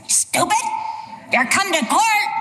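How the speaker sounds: high-pitched scornful judge; exasperated bench scolding; Judge Judy. Acted. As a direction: cut the litigant off with mock outrage. Acted Judge Judy snapping back.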